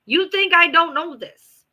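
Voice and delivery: loud, slow, high exasperated demand; exasperated yell. Real, not acted. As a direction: hit it with frustration. Ranting about being played.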